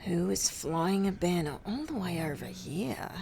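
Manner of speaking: soft, breath-only musing; wondering to herself. Acted. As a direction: whisper the puzzled question.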